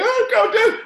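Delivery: high, strained mock sob; imitating crying runner. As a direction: squeak it out like a crying exhausted kid. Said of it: "And they're like".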